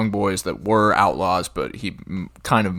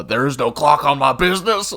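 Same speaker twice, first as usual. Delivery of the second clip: higher, louder put-on voice; Rooster quote; 8 semitones higher, 8 dB louder than usual. Quoting Rooster's favorite line to a friend, higher put-on voice. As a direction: growl it like a stubborn marshal. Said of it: "another one that I say".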